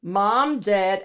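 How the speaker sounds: plain reading voice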